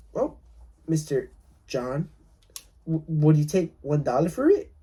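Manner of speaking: ordinary talk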